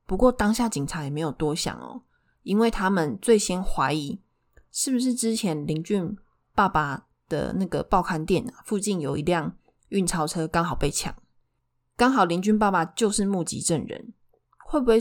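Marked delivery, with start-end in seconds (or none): none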